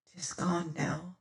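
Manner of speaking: breathy whisper, barely there; under the breath. A narrator's reading. Martin whispering to his frightened companion in the cave. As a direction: whisper it so no one overhears. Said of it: "speaking under my breath".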